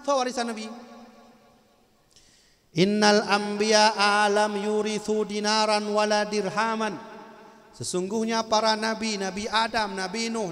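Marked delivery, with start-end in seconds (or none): 2.8-6.9 loud, slow Arabic recitation; hadith recitation. Acted. Preacher quotes the Arabic hadith to the crowd, slow and loud. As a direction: declaim the hadith deliberately.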